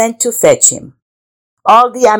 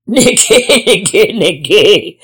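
lion's laugh; 4 dB louder than usual. A narrator's reading. Lion hears the iguana's explanation, laughing. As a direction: chuckle theatrically as an amused lion. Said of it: "laughed the lion".

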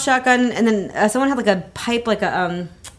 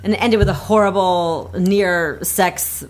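ordinary delivery

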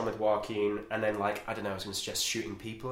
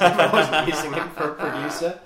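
laughing through the words; 6 semitones higher, 10 dB louder than usual. Real, not acted. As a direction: chuckle as you say it. Joking about betraying Joaquin.

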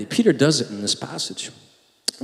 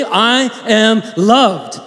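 bold affirmation; 6 semitones higher, much slower than usual. Shown as a demonstration. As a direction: lead the room in it boldly. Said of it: "You say it with me".

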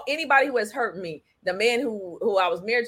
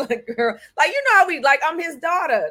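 ordinary talk, nothing marked

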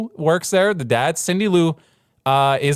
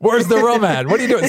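hammed-up nosy drinker; 6 semitones higher, 3 dB louder than usual.

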